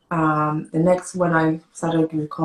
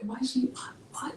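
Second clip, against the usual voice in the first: lightly acted whispered bewilderment; hushed disbelief; 8 semitones higher, 10 dB quieter than usual. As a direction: whisper it, as the baffled doctor. Doctor's hushed disbelief, retold.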